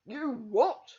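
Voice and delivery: loud, outraged bellow; roar. Acted. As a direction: blast the question at her. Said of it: "roared the man".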